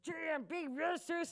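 high-pitched mock protest; hammed-up exasperated mom; mom screaming. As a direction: imitate mom insisting, voice shooting up. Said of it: "if she's screaming at me".

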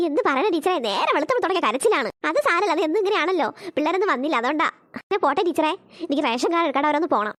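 a busy mom rattling off excuses; hurried mother. Acted. Mother voice talking to teacher.

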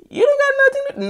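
squeaky pitch-up mock of a nosy person; high mocking voice. Mimicking a nosy person asking about her plans, high and mocking. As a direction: shoot it up high like a nosy busybody.

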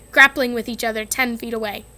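usual speaking voice

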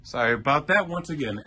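ordinary talk, nothing marked

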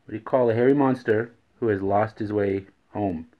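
usual speaking voice

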